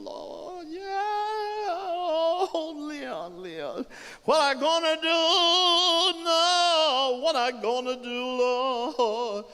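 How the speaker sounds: very high, slow, half-sung keening; wailing grief. Acted. Laura May as they carry Leon, wailing. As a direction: build into a loud drawn-out wail. Bereaved woman wails following the body.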